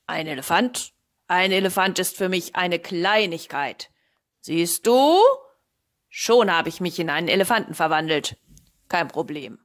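lightly voiced boastful wizard, loud; boastful sorcerer. Sorcerer shows off to the cat, loud boasting. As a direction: boast loudly of your powers.

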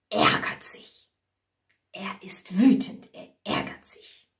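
hoarse, voiceless growly whisper; seething. A narrator's reading. Narrator tells how the wolf is furious, seething whisper. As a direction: force it out under the breath, angrily.

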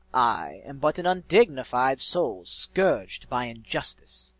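loud, slow, grandiose villain voice; eloquent sly villain. A narrator's reading. Mysterious voice offering the griffin vengeance, slow malevolent grandeur. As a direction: draw out the words theatrically, teasing.

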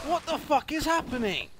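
high, loud yelled question; shocked shout. Real, not acted. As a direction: shout it in disbelief. Player shocked by game chaos.

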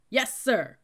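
loud, high recruit's reply; yelled response. A narrator's reading. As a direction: yell it like a whole squad answering. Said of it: "we all yelled in unison".

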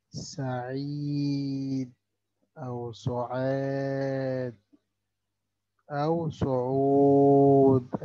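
سعيد سعاد سعود with the long vowel drawn out very slowly; long vowel demo. Shown as a demonstration. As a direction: stretch each long vowel. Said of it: "ثلاث كلمات فيها مد". (مد الحروف ببطء شديد)

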